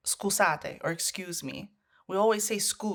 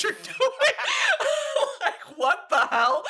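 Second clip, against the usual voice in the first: high, loud laughing outburst; cracking up at the absurd spa menu; shouting laugh; 3 dB louder, slower than usual. Real, not acted.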